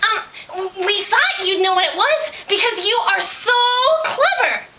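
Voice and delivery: high, stammering start, then gushing; hesitate, then squeak out the praise; high-pitched storybook pig; flustered pig. A narrator's reading. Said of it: "said Pig".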